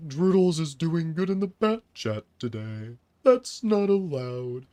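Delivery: warped slow robot voice, like a glitchy AI. Real, not acted. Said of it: "Why do you sound like pitched down GLaDOS".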